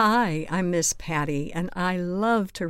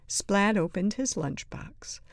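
plain reading voice

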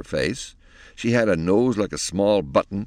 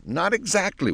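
put-on girl's voice; 13 semitones higher than usual. Penelope replies.

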